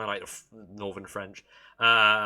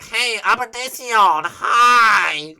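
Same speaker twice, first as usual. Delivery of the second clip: very loud, high, drawn-out mock greeting; mock accent yelled high and loud; mocking impression; 9 semitones higher, 11 dB louder, much slower than usual. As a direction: holler it slowly, high, as a jeering caricature. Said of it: "it's better than sounding like".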